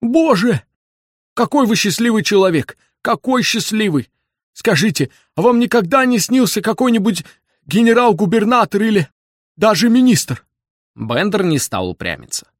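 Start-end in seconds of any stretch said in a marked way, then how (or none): 0.0-10.4 put-on high, loud, eager old man; excited old man. A narrator's reading. Old man envies Bender's dreams, high excited gushing. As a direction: gush in a high, agitated voice.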